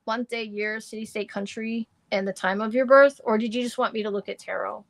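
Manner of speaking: ordinary delivery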